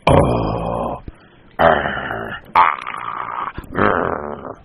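series of drawn-out grumbling growls; imitated grouchy growls, mostly low; muttered growling. A narrator's reading. Imitated growling of boys at their mother, grumbling growls. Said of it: "growling at their mother".

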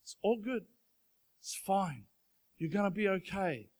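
soft, gentle reassurance; calm the crying child down; a parent comforting a hurt kid, soft and calm; soothing a hurt kid. Retold or imitated. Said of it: "I picked them up and I said".

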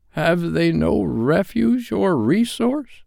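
anguished cry. Acted. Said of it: "cried Scrooge".

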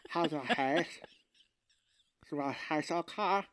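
mock numbed-mouth slurring; numb Novocaine mouth. Recapper mocking actor on Novocaine, slurred numb speech. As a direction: slur as if fresh from the dentist. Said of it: "so they gave him some Novocaine while he shot his scenes".

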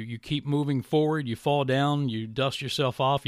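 ordinary talk

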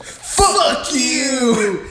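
high, drawn-out imitation of a defiant boy; mock defiant yell. Boy cursing at his principal, hammed-up defiance. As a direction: stretch it out high, playing at fury.

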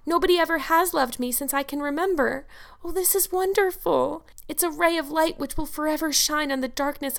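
put-on high, wondering girl's voice, read aloud; overjoyed Anne. A narrator's reading. Anne learns her bosom friend loves her, overjoyed. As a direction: lift it high with romantic wonder.

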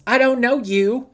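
hammed-up yell in a kid's voice; mock kid's yell. Translating the kid's yell for the cohost, a mock high shout. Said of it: "It means".

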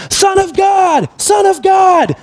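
high, drawn-out shout for attention; imitated far-off yell from a blind follower; yelling crowd call. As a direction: holler it long and high, dropping at the end. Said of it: "these two blind dudes are yelling".

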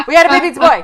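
high, loud, proud announcing; commercial line. Retold or imitated. Quoting an old phone commercial to her cohost, loud proud announcement. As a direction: belt it out like a proud new dad on an ad. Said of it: "there was a commercial".

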